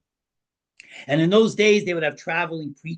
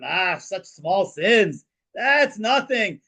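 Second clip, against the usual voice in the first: slow, higher, waving the sins away; drawn-out, airy brush-off; dismissive scoff; 6 semitones higher, much slower than usual. Acted. Chassid brushing off the rabbi's confessed sins, slow and scoffing.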